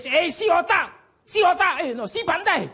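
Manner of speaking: high-pitched, jeering call-out; mock scolding. Stranger tells off a driver in local language, mock scolding. Acting the woman scolding him.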